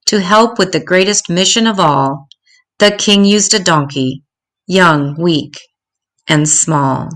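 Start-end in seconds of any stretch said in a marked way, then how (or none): none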